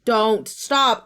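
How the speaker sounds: ordinary delivery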